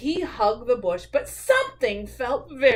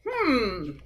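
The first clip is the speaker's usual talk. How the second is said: usual speaking voice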